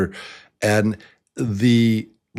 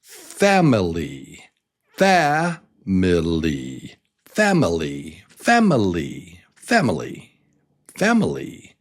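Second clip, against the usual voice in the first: Family as FAM-i-ly; stress on first syllable. Shown as a demonstration. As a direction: open strong on FAM in Family, then drop. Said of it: "starts with the stress on the first syllable".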